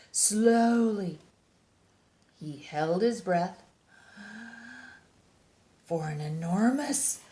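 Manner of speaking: lively picture-book read-aloud; reading to children. A narrator's reading. Reading a bear picture book to young listeners, animated storytime voice. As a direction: read it like storytime for little kids.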